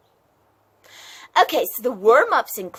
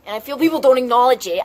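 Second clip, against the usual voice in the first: ordinary talk, nothing marked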